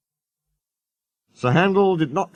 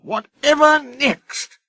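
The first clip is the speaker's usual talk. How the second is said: very high, slow scornful snort; disgusted engine scoffing at cattle trucks; much slower than usual. A narrator's reading. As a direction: sneer at the very idea. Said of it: "he snorted".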